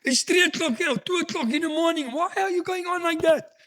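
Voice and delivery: wife's voice. Retold or imitated. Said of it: "she says".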